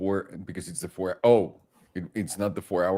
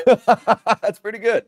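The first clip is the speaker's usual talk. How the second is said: slow, high, laughing praise; amused, still laughing; 13 semitones higher, 8 dB louder, slower than usual. Real, not acted. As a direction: grant it through laughter. Laughing at the accent.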